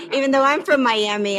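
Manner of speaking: a real amused laugh through the words. Real, not acted. Guest laughing back at the chef about her accent.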